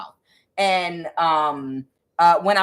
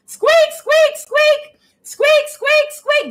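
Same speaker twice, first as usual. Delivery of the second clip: very high, loud bird squeaks; piercing mock birdcall; squeaking bird; 7 dB louder, much slower than usual. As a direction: imitate a screeching bird in falsetto. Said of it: "did y'all hear a bird in the background going".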